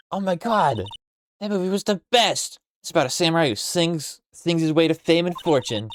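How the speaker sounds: high, excited character voice; hammed-up, high-pitched fan enthusiasm; gushing fan. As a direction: gush about a favourite film.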